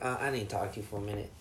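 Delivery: low, quick, quiet boss voice; mock boss. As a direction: mumble it like a curt boss. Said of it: "he's like".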